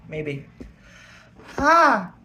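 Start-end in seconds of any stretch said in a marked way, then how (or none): 1.6-2.1 pained cry. Real, not acted. Pain from spicy food.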